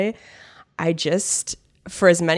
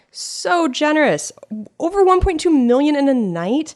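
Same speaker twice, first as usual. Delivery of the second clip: higher, slower amazement; excited gush; 10 semitones higher, 4 dB louder than usual. Real, not acted. Host amazed at the donors, excited and high. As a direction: marvel at it.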